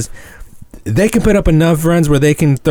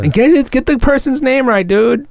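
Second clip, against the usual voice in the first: exasperated. Real, not acted. Annoyed at friend's name mistake.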